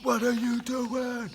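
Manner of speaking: high, slow, exasperated yell at a teammate; mock the kid berating his teammate, stretched out and shrill; frustrated kid yelling. Coach imitating a kid yelling at a teammate, high exasperated yell. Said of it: "Kid's just freaking out over a dropped pass".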